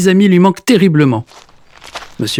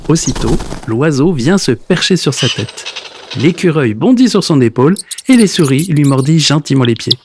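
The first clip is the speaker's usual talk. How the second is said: usual speaking voice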